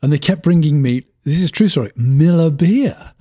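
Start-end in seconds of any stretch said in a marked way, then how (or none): 1.9-3.1 Miller beer very slowly; drawn-out punchline. Retold or imitated. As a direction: stretch out both words of Miller beer. Punchline of misheard order.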